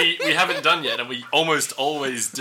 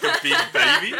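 laughing; 9 semitones higher than usual. Real, not acted. Laughing while teasing Grace.

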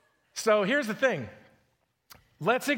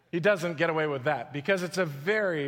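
usual speaking voice

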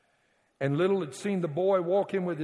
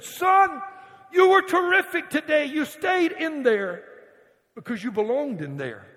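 much higher and louder, bursting with pride; excited praise; 10 semitones higher, 6 dB louder than usual. Acted. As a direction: shout the praise like a proud coach. Coach praises boy after victory.

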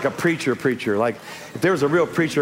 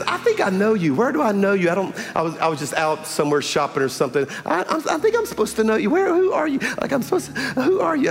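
higher, fast puzzled woman trying to place him; puzzled lady; 7 semitones higher than usual. Retold or imitated. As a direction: mimic a confused lady squinting at a face.

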